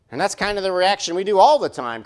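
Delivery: ordinary talk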